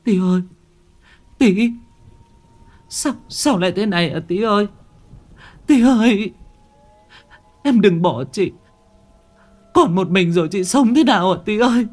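high, loud anguished wail. A narrator's reading. Thủy wailing over her drowned little brother, pleading. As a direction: cry out to the dead brother, begging. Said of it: "vật vã gào khóc".

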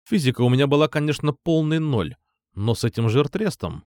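ordinary delivery

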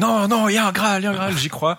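high, pleading denial; insistent believing knight. Retold or imitated. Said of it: "Puis lui, il dit".

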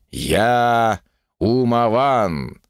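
drawn out, grand and slow; slow solemn proclamation. A narrator's reading. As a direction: stretch Умаван slowly, solemnly. Solemn self-naming.

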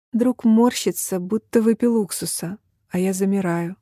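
ordinary delivery

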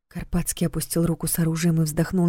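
usual speaking voice

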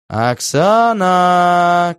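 long drawn-out yell of a name; shouting. A narrator's reading. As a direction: shout the name into the speaker, stretched. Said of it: "кричу я в динамик".

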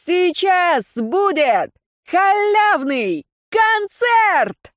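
high, slow stage shout; rally speech. A narrator's reading. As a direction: hype the free concert. Singer rousing crowd.

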